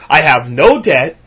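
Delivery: ordinary delivery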